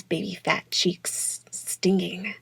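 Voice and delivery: slow, breathy whisper. Acted. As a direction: let it fade to a whisper. Unvoiced whisper in the poem.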